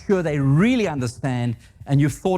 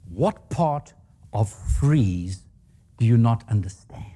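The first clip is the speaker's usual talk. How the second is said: very slow, lower, each word spaced out; furious boss; 5 semitones lower, slower than usual. Retold or imitated. Boss thumps the desk at hiring freeze, slow lower fury. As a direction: drag out "freeze" like a livid boss.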